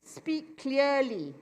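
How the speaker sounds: each word drawn out slowly and clearly; slow, careful demo. Shown as a demonstration. Teacher modelling clear speech for students, slow and deliberate. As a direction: show the class what clear speech sounds like. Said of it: "speak slowly".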